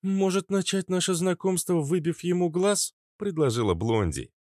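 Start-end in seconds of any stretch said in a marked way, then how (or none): 0.0-2.9 put-on young female voice. Blondie proposes.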